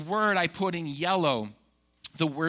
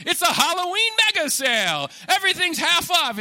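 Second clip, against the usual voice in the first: high, loud hammed-up sale pitch; parodying a store ad; mock ad announcer; 12 semitones higher, 6 dB louder than usual. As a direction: shout it like a cheesy TV commercial. Mocking the furniture store ads.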